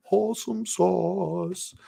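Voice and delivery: playful catchphrase. Real, not acted.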